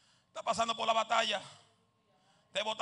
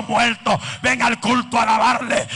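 ordinary talk

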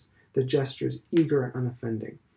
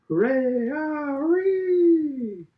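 high, loud schoolboy cheer; hooray shouted high and stretched; 8 dB louder, much slower than usual. A narrator's reading. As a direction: whoop it like boys at a match. Boys cheering outside.

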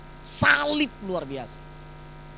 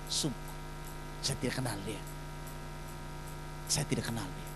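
low, hushed swearing of denial; 11 semitones lower, 17 dB quieter than usual. Acted. Peter swears to the accusers he never knew Jesus, hushed. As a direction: deny it under your breath, scared to be heard.

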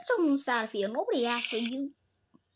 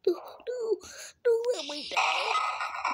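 mock whine; 9 semitones higher, slower than usual. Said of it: "He was like".

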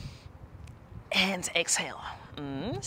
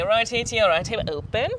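ordinary talk, nothing marked

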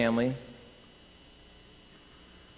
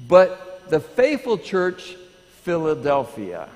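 faithful stretched slow and louder; emphatic preaching; 3 semitones higher, 5 dB louder, slower than usual. Acted. Pastor stressing the faithful church, slow and loud. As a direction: lean on faithful.

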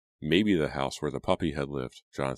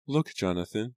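put-on higher, mildly sorrowful girl; a touch of sadness, pitched up; sad; 6 semitones higher than usual. A narrator's reading. Abigail shows Jonathan the bones, a little sadly. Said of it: "she said sadly".

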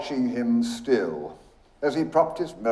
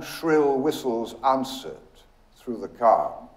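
ordinary delivery

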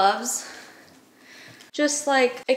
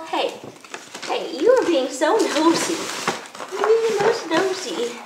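high teasing scold to a pet; playful scolding; 6 semitones higher than usual. Real, not acted. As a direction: tease the nosy dog.

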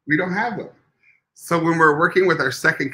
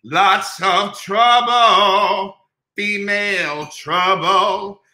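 very slow, higher put-on voice; movie line quote; 5 semitones higher, much slower than usual. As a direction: stretch each word out like the film character. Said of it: "Taffy Davenport talks to her mother".

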